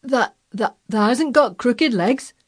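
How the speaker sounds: put-on stammering, lightly hoarse old man; hoarse quaver. A narrator's reading. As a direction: quaver it in Yorkshire dialect. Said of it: "quavered Ben, more hoarsely yet".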